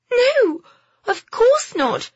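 indignant. Acted. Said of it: "Phoebe glared at him".

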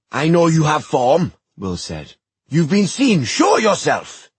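loud, high demanding yell; angry shout. Acted. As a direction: demand answers furiously. Will demanding.

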